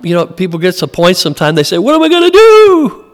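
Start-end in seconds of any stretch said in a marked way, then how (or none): 1.8-3.0 high, louder panicked cry; imitating a panicked believer, pitched up; mock panic. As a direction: squeal it like someone freaking out. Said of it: "they freak out".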